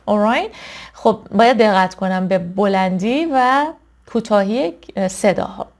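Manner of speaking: ordinary delivery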